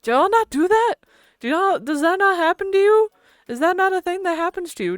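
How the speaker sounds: incredulous question. Real, not acted. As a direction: ask as if you can't believe it.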